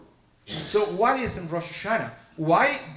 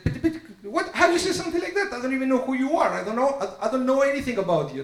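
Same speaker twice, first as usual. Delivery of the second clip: shocked outburst; 8 semitones higher, faster than usual. Retold or imitated. Caterer's shocked outburst retold.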